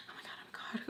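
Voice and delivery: retold nervous gasp under the breath; nervous whisper. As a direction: whisper it to yourself, flustered. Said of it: "make me really, really nervous".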